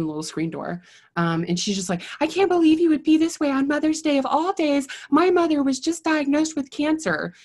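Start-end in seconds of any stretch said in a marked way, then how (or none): 2.2-7.3 high, louder whiny outrage; mock her as a self-righteous victim; hammed-up whiny neighbor; indignant lady. Mimicking neighbor's indignant outburst.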